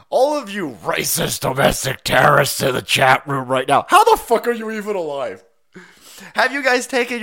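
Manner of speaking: loud, pretend accusing tirade; mock outrage. Pretend outrage at chat room.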